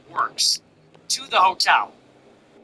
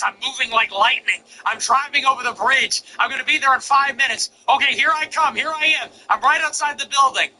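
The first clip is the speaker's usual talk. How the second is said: higher and higher, rushing to arrive; eager updates; 8 semitones higher than usual. Retold or imitated. Delivery driver texting the hungry streamer his progress, eagerly building higher. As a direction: play the driver racing over the bridge.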